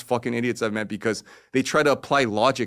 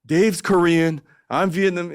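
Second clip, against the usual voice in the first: translator correcting; 7 semitones higher, 4 dB louder, slower than usual. Retold or imitated. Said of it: "And he was like".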